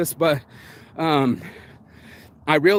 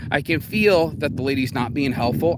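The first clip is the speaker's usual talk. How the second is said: normal talking voice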